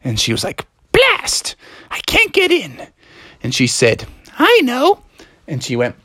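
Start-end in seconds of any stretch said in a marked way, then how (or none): 0.9-2.9 very high, cross exclamation; frustrated goblin villainess. Acted. Anne cannot open the door, high frustrated villain voice. 4.4-5.0 high-pitched sudden idea; scheming goblin villainess. Acted. Anne thinks of calling goblins, high scheming voice.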